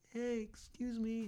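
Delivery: put-on high, slow, small kid voice; scared kid asking the teen guide, high; timid little kid. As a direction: ask it shyly like a child.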